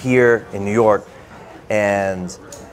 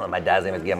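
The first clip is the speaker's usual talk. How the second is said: usual speaking voice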